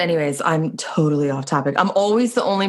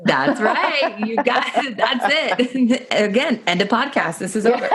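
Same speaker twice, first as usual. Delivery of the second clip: laughing; 5 semitones higher, slower than usual. Real, not acted. Jokingly ending the podcast.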